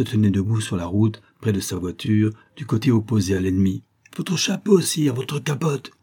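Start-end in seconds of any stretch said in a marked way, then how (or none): 4.2-5.9 high-pitched call to the emperor; urgent cry. A narrator's reading. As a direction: cry out an order, pitch leaping up. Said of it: "lui criai-je". (cri aigu et pressant)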